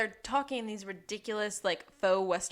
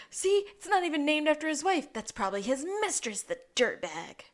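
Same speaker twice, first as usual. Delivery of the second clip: smug detective voice; 7 semitones higher, 3 dB louder than usual. Retold or imitated. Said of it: "Lassie's like".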